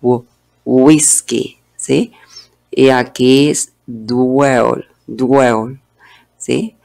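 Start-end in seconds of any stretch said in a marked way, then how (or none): 0.0-1.6 slow "whisky" with a rounded English w, not gua; slow w sound demo. Shown as a demonstration. Teacher to Spanish speakers, slow careful "whisky". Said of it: "la pronunciación es w". 3.8-5.8 "dwell" said slowly, d and w both sounded; slow dwell demo. Shown as a demonstration. Teacher drilling learners, slow clear "dwell". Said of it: "Están sonando las dos consonantes".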